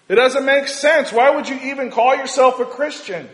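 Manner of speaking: loud high impassioned preaching; preaching at full force. Acted. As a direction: preach with rising fervor.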